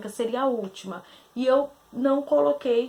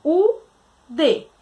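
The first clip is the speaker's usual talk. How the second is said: syllables split apart; 5 dB louder, much slower than usual. Shown as a demonstration. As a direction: sound out sa, u, d one by one. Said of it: "na separação de sílabas".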